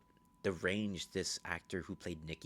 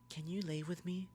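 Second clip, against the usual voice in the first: high put-on girlish voice; high, sweet-pleading imitation; Nikki; 11 semitones higher than usual. Nikki asks Bear to lie with her, high pleading imitation. Acts Nikki asking him to bed.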